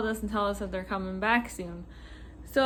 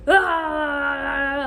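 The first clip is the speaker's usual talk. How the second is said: loud, raised wordless groan; mock angry yell; 4 semitones higher, 6 dB louder than usual. Customer unhappy with the payout figure, mock angry yell. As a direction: imitate a customer erupting in frustration.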